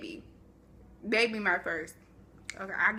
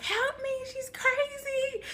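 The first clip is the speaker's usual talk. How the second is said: mock scream for help; slower than usual. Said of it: "you hear me screaming".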